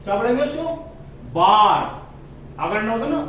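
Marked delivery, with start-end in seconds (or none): 1.3-1.8 बार with a long open aa, said firmly. Shown as a demonstration. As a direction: stretch the aa in बार. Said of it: "वो आ का sound करता है".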